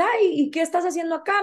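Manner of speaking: lightly voiced curious señora; humble Mexican woman. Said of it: "había una señora humilde de Aguascalientes".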